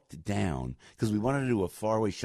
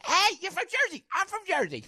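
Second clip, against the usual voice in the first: high, strained Jersey-lady squawk; imitating Jersey lady. Joe mimics a fan's high squeal at him. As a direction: mimic the woman shouting about Jersey, pitched way up. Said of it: "that's all she kept saying".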